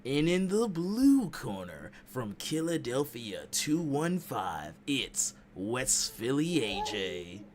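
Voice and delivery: slow booming ring introduction; boxing ring announcer. Pretend. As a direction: stretch each word for the crowd. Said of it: "Ring announcing is, is real".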